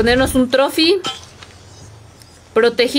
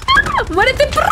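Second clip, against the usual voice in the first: excited high shout; 10 semitones higher than usual. Real, not acted. Gamer fighting an enemy.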